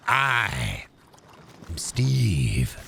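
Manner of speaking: low, slow spooky reveal; zombie Steve reveal. Retold or imitated. Imagining a creepy reveal, a low menacing line. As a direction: loom out of the dark. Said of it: "popped up behind somebody like a zombie".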